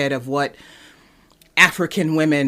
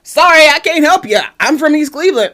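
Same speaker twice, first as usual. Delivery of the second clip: higher, louder mock-sorry brush-off; mock apologetic; 11 semitones higher, 7 dB louder than usual. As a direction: play it as a cheeky fake apology. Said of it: "And I'm just like".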